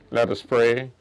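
ordinary talk

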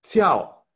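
Jiao said with a sharp falling tone; Mandarin syllables. Shown as a demonstration. Teacher drilling Wo Jiao for the class, sharp falling tone. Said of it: "de nuevo".

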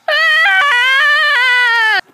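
high shouted scream of pain; sudden loud pained cry. Acted. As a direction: yelp as if struck hard.

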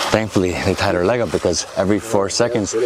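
normal talking voice

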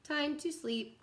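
slow, gentle storybook read with long pauses; reading to children. Acted. Teacher reading a bedtime picture book to kids, slow and gentle. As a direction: lull the little ones toward nap time.

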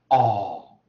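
"哦" as one loud, long open vowel; lengthened vowel, no R. Shown as a demonstration. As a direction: hold "哦" long, never curling into R. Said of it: "的这音延长". (英式长音 "哦"，不卷舌)

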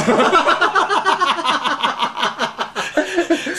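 high hearty laugh; high burst of laughter. Real, not acted. Laughing at the story.

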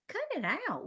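high-pitched, embarrassed objection; exasperated protest. A narrator's reading. Peter to teasing Jimmy and Alex, exasperated protest. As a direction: tell teasing friends to stop.

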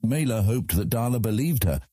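plain reading voice

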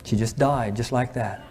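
hushed, sombre. Real, not acted. Preacher tells of father-in-law finding his wife dead, hushed. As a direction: let the voice sink for a sudden death.

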